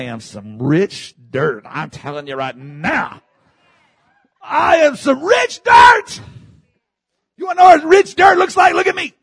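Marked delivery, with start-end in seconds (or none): 4.4-6.2 slow, high, loud declaration; full-force, high-pitched proclamation; exultant preaching shout. Real, not acted. Same words repeated as a shout. 7.4-9.1 high, loud challenge to the crowd; high-pitched, pulpit-filling cry; preaching shout. Real, not acted. Shouted boast to congregation.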